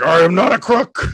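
hammed-up Nixon. Comedian as Nixon denying he is a crook, hammed-up protest.

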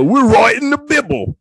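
high, hammed-up English accent; obnoxious English accent. Bible writers announcing their work, obnoxious English accent. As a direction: put on a comically broad English accent. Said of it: "an obnoxious English accent".

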